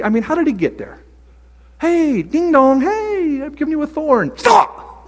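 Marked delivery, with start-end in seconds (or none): 1.8-4.3 a goofy courier dropping off a thorn; doorbell delivery guy. Pretend. Messenger at Paul's door, high silly delivery voice. 4.4-5.0 mock yell. Shouted interruption, put-on voice.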